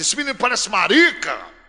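mock the harsh father; quoting father. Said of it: "Meu pai dizia". (pai ríspido, imitado em voz alta)

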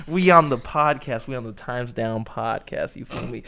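ordinary talk

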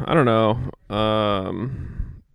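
ordinary talk

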